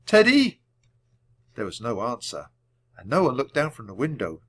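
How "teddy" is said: high, loud repeated call. A narrator's reading. Jack gets no reply from the treehouse, calling. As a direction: holler up for a friend. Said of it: "Jack called".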